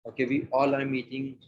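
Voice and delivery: ordinary delivery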